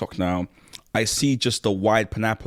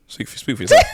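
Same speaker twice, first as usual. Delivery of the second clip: laughing. Real, not acted. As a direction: laugh as you tease back. Playful laughing retort.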